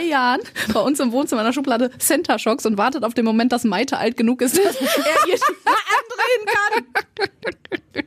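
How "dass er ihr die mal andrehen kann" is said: high, slow words through laughter; real laughter squeezing the voice; laughing while talking. Real, not acted. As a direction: crack up before finishing the sentence. Laughs through own anecdote.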